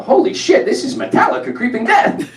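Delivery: high, sudden excited outburst; excited blurt. Retold or imitated. At Passover dinner, blurting out in excitement. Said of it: "all of a sudden I just blurt out".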